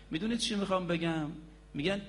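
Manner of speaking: ordinary talk, nothing marked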